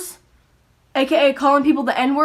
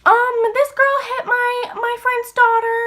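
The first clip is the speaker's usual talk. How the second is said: much higher, slow, fussy mom; mock mom voice; 11 semitones higher, slower than usual. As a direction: play the tattling mom, high and slow. Voicing the friend's mom.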